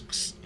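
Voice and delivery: short voiceless "kss"; quick hissed noise through the teeth; imitated hiss. Imitating King Kong girl as she points, short hiss.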